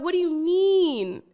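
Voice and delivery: high, slow stunned disbelief; shocked question. Retold or imitated. Driver asks the brother, high and stunned.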